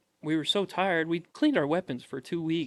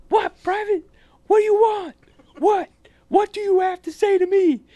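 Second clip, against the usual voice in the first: very high, full-volume imitated bellow; drill sergeant yell; 12 dB louder than usual. Drill sergeant challenging a private who spoke up, yelling.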